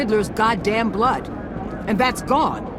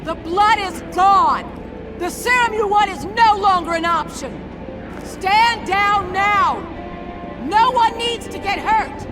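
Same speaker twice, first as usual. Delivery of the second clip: shouted command; 14 semitones higher than usual. Acted. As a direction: holler each phrase across the bridge. Calling out orders in standoff.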